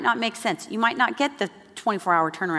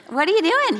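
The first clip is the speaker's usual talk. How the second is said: much higher, louder puzzled question; surprised question; 11 semitones higher, 5 dB louder than usual. Retold or imitated. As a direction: ask in baffled surprise. Puzzled wife on the phone.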